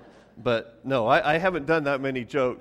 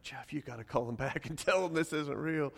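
laughing urgent plea; 3 semitones higher, 4 dB quieter than usual. Retold or imitated. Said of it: "I'm like".

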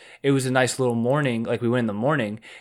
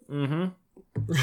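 sipping through a straw; 4 semitones higher, 9 dB quieter than usual. Real, not acted. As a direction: hum while sipping a drink. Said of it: "Sorry, I'm drinking our sponsor right now".

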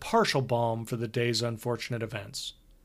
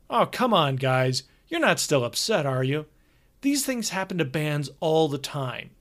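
brash, cheerful tour manager; hearty road manager; 2 semitones higher than usual. Acted. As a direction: play the chummy older road manager. Hogarth voice again.

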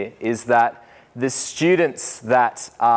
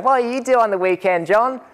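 put-on cheeky kids; 11 semitones higher than usual. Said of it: "kids up on the desk going".

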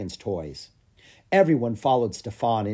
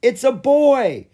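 much higher, louder, slow announcement; joyful cry; 13 semitones higher, 10 dB louder, slower than usual. A narrator's reading. Grom announcing the dragon's sex, joyful cry. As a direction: cry out the news with joy. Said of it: "cried Grom".

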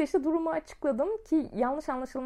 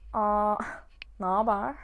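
slow, lower, syrupy suitor greeting; imitating his sweet tone; 4 semitones lower, slower than usual. She imitates the flirty guy answering her call, slow and sugary. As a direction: mimic the guy's honeyed hello, slowly. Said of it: "ses tonlamasını yapmaya çalışacağım".

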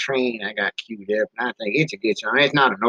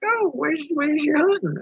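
Mama Bear voice; 13 semitones higher than usual. Retold or imitated. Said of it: "And she's like".

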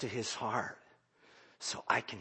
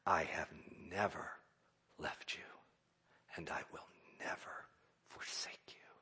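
soft, fading whisper; tender whispered comfort; whispered promise; 6 semitones lower, 11 dB quieter than usual. Retold or imitated. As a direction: hand over the promise gently. Recalling God's words, hushed.

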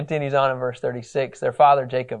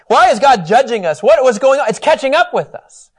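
panicked brothers; 7 semitones higher, 7 dB louder than usual. Retold or imitated. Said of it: "And they says".

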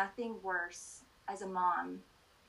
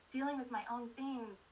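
ordinary delivery

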